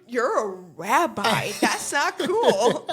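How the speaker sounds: mock indignant. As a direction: scold the rabbi in mock outrage. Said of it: "I was like".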